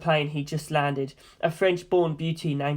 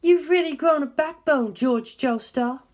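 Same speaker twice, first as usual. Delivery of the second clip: mocking lady; 9 semitones higher, slower than usual. Darlington mocks George.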